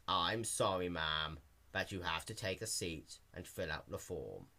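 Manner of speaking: low, soft monotone apology; bored clerk. A narrator's reading. Clerk sending Dash to sit, low flat drone. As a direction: recite it without caring.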